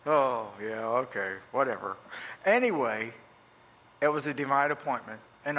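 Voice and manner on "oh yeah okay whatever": low-pitched, offhand imitation of indifference; dismissive reply. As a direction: imitate someone brushing off advice. Retold man brushing off the advice.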